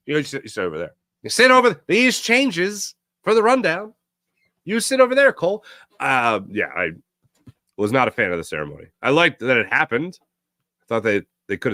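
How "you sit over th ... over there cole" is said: higher, improvising emcee, lightly mimicked; mock stage directing. Host mimics an emcee seating the honoree, mock-flustered. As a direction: imitate someone fumbling through live directions.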